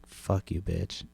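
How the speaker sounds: soft, dropping curse; a quiet imagined retort under his breath; muttered comeback. Retold or imitated. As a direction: mutter it, not meant to be heard.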